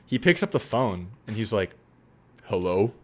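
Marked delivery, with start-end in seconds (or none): 2.5-2.9 low-pitched American-style hello; questioning hello, dropped down low; deep non-British voice. Retold or imitated. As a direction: imitate a deep voice without the British accent. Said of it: "a really deep non-British accent voice".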